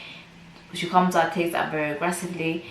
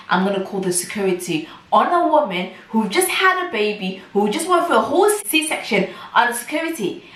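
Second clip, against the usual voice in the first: raised, high-pitched outrage at the threat; appalled, emphatic and higher; indignant retelling; 5 semitones higher, 4 dB louder than usual. Retold or imitated. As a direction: punch it out in disbelief, pitch climbing.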